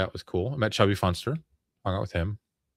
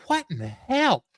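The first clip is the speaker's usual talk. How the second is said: baffled dad. Retold or imitated. Dad's baffled quote, retold.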